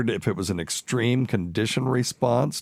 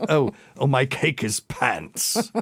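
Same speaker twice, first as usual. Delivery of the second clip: slow, higher put-on British lady; mock British accent; 6 semitones higher, slower than usual. Mocking a fake Texan using British slang in a hammed-up accent. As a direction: ham up a fake English accent, laughing. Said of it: "So if she says".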